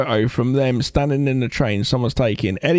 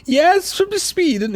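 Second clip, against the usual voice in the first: high, drawn-out mock-Swedish accent; slower than usual. Jamie Lee Curtis answering Coleman's doubt, mock Swedish accent. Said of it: "Jamie Lee Curtis comes up and says".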